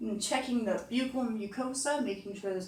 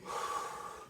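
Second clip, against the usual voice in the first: slow audible exhale; deep breath out; 7 dB quieter than usual. Real, not acted. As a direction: breathe out deeply for the doctor. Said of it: "take a deep breath in, then out".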